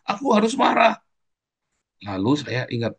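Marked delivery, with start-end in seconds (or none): 0.0-1.0 much higher mock insistence; mock hothead. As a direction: mimic the angry excuse. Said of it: "alasan kita yang pemarah".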